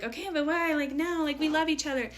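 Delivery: mock protest. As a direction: lightly act out someone pushing back. Voicing viewers' protest to her.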